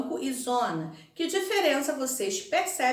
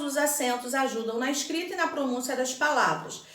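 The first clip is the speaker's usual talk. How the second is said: ordinary delivery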